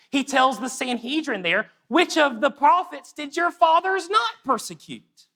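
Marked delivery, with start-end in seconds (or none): 1.9-5.3 high, pointed sarcastic question; sarcasm. Acted. Stephen telling off the Sanhedrin, high-pitched sarcasm. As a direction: fling it high at the accusers. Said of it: "That's sarcasm".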